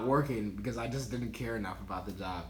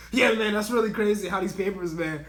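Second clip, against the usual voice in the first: louder, higher fake small talk; pretend casual chatter; 6 semitones higher, 4 dB louder than usual. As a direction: make a show of being busy talking. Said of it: "pretend like I was like".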